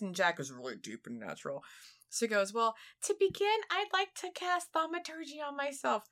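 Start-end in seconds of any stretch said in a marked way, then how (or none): 2.5-6.0 high squeaky kobold voice. Retold or imitated. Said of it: "really high squeaky voice".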